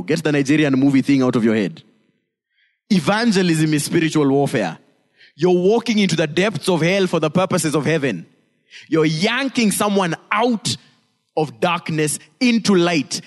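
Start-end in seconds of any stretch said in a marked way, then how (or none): none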